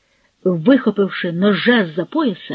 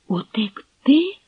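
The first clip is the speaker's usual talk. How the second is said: breathless whispered question; 5 semitones higher, slower than usual. A narrator's reading. Girl frightened by the elopement plan, whispering. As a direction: hush it as if afraid to be heard.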